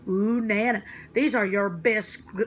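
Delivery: plain reading voice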